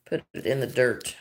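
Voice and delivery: ordinary talk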